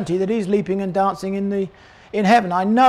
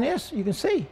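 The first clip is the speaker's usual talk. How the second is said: much softer, tender and high; tender declaration; 4 semitones higher, 8 dB quieter than usual. Retold or imitated. Jesus softly telling the blind man he sees. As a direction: say it softly, like a gift. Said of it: "Jesus says to the blind man".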